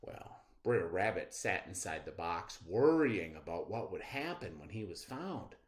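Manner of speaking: usual speaking voice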